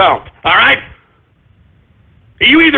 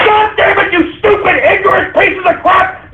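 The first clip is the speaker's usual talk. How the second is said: furious shout; 9 semitones higher than usual. Real, not acted. Host yelling at ignorant viewers, furious shout. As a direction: let the rage explode at full volume.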